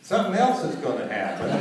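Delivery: words broken by laughter; can't stop laughing mid-line; laughing through words. Real, not acted.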